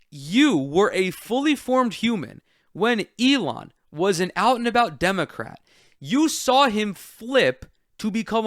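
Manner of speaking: heated, emphatic rant in real exasperation; worked up. Real, not acted. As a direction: let rip with indignant, punched swearwords. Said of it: "I'm getting worked up".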